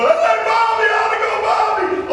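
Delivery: very high, whooping imitation of a dad; excited father's cheer. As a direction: act out a father jumping and cheering. Retold father jumping and cheering.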